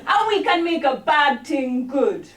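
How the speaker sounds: punchline in mock Jamaican patois; Rastamouse patois. Comic closes the Rastamouse poem, mock patois. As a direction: deliver the catchphrase in put-on Jamaican. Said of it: "more practice on this accent".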